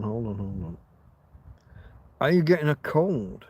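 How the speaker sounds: ordinary talk, nothing marked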